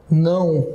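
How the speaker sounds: "Não" with the O sounding like U; "Não" on its own, closing on a U sound; pronunciation demonstration. Shown as a demonstration. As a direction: say "Não" as a nasal glide ending in U. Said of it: "Eu falo assim".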